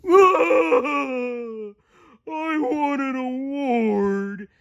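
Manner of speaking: high, slow mock wail in Patrick voice; a starfish bawling over the trophies. A narrator's reading. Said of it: "Patrick wailed".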